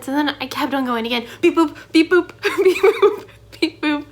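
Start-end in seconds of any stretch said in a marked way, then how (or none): 1.4-4.1 high, loud robotic beeps breaking into laughter; laughing machine noise. Shown as a demonstration. Student redoing the endless machine demo, laughing high beeps. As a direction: beep higher and louder, cracking up.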